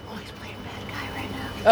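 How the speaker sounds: whispered aside; imitated parental whisper. Parents in the crowd to each other, whispered aside. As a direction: whisper it like a parent explaining the show.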